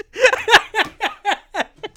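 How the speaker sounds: loud, high burst of laughter. Real, not acted. Host laughing at own Queen joke, loud laugh.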